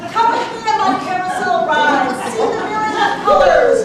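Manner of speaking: loud, grand showman tiger; boastful tiger. Acted. Tiger boasting of itself to the audience, loud and grand. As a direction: proclaim yourself like a circus star.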